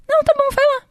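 high, breezy mock-easygoing voice; imitating Merigo. She imitates Merigo letting her go, high and breezy. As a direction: toss it off lightly, way up high. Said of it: "É que era assim".

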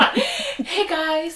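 high, loud bubbly vlogger voice; hammed-up vlogger greeting; mock influencer intro. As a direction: gush like a beauty influencer.

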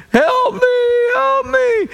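high, shouted cry for help; desperate plea. Retold or imitated. Moses crying out to God, shouted high plea. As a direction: wail like a panicked Moses begging. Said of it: "Moses is like".

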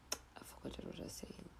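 barely audible unvoiced whisper; hushed admission of forgetting. Real, not acted. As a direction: whisper it sheepishly. Losing track.

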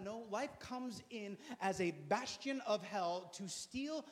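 ordinary talk

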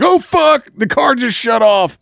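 retold shock. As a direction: panic as the engine dies. Truck cuts out at ninety.

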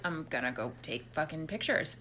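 low, soft imitation of a sneak; retold sneaky aside. Man plans to take pictures while everyone's asleep, mock-sneaky low voice. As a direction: quietly mimic someone plotting under their breath. Retold night-time plan while others sleep.

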